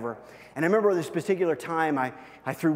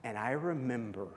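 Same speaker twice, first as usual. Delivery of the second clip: hushed tender memory; 3 semitones lower, 11 dB quieter than usual. Real, not acted. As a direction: tell it softly, moved by the memory.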